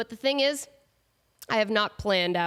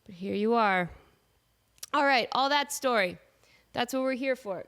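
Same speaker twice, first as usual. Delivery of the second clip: ordinary talk, nothing marked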